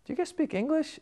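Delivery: incredulous question. Retold or imitated. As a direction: squeak it out in disbelief. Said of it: "I go".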